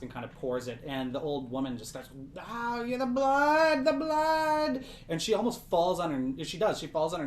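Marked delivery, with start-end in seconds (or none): 2.4-4.8 high, wailing religious ecstasy; ecstatic cry. Acted. Old woman falling to her knees before the blood, ecstatic. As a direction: cry out high, overcome with rapture. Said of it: "like, an ecstatic".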